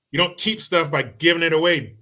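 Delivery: normal talking voice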